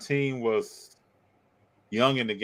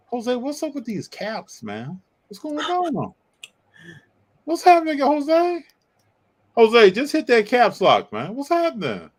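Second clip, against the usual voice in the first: high squeaky voice; 11 semitones higher, 5 dB louder than usual. Pretend. Teasing chatter about caps lock.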